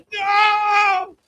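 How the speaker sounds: a loud, high "No!"; mock alarmed shout. Parents stopping the sign, loud high mock shout. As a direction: stop him at once.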